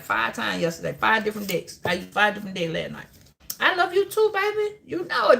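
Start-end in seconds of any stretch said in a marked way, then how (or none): 3.6-5.4 affectionate reply. Real, not acted. Answering a viewer affectionately.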